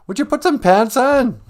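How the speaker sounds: very high, loud shrill demand; scandalized Eve. Acted. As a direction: Eve yells at naked Adam, scandalized. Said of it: "she's like".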